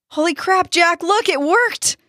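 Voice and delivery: high, thrilled shout; can't believe it worked; overjoyed. Acted. Dustin overjoyed at success.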